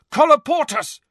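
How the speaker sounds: loud, high spell cry; shouted. A narrator's reading. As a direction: shout the incantation urgently. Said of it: "shouted Harry".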